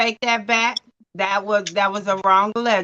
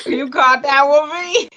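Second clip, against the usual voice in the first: laughing; 4 semitones higher than usual. Real, not acted. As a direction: blurt it out through a laugh. Laughing at a friend's joke.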